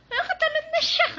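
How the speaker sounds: very high, loud, thrilled cry; delighted squeal. Real, not acted. Mom thrilled her toddler jumped, high delighted squeal. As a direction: squeal with joy at the first jump. Baby jumps, mother delighted.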